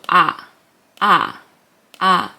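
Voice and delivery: plain open "ah" with no consonant; silent consonant. Shown as a demonstration. Teacher showing the silent letter as "ah". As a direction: say "ah" with nothing before it. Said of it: "it's gonna be silent".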